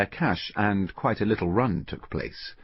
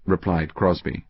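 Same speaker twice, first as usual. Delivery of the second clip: plain reading voice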